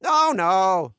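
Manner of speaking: high, loud denial; emphatic high-pitched character voice; dismissive Oleg. A narrator's reading.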